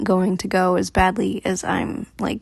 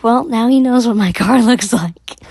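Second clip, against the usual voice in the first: louder, breaking into a laugh mid-line; laughing in dismay; 3 semitones higher, 6 dB louder than usual. Real, not acted. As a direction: laugh nervously at how bad it is. Nervous laugh at realisation.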